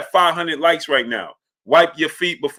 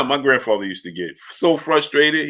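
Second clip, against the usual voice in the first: ordinary delivery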